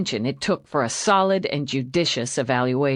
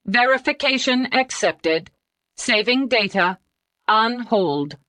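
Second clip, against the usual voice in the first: higher machine voice; computer voice; 6 semitones higher than usual. Acted. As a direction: answer like a computer. Computer replies.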